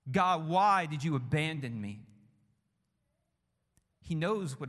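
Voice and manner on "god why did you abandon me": a voiced cry of abandonment, not a full scream; crying out to God. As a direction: cry it out like a man abandoned on the cross. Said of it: "he's crying out".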